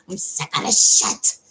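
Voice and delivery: loud, slow, voiceless rasp; mock fed-up outburst. Voicing someone giving an ultimatum, fed up. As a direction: force it out slowly, exasperated.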